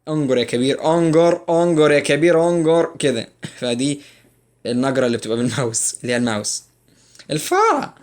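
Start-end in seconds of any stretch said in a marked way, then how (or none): 0.1-3.3 louder, higher and slow, urging someone on; hammed-up cheering. Teacher playfully cheering on a mouse drag, hyped. 7.3-7.9 very high squeak on الفاره; squeaky mouse voice. Pretend. Teacher naming the computer mouse in a squeaky mouse voice.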